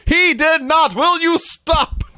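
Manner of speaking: high-pitched mock outrage, drawn out on STOP; indignant protest. Gorilla scolding his fellow announcer, indignant mock outburst. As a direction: protest in exasperated disbelief. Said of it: "Gorilla will not abide".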